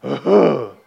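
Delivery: loud, short mock sob; imitated sob. Newcomer on the steps hearing himself, imitated sob. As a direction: burst out with one acted sob. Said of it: "and I'm sobbing".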